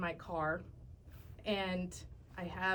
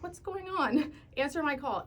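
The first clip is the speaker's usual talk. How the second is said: alarmed friend; 6 semitones higher than usual. Retold or imitated. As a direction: demand an answer urgently.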